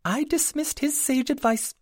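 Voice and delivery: very high fairy voice, rapid and apologetic; a tiny owner owning up, a bit embarrassed; sheepish pixie. A narrator's reading. Pixie continues her confession.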